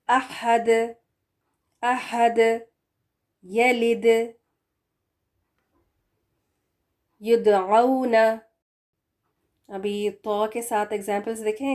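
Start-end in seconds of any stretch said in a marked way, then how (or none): 0.1-8.2 احد with a bounced final د; qalqala dal. Shown as a demonstration. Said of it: "وہ کس طرح سے کریں گے". (خلخلہ کے ساتھ، د کو باؤنس کر کے)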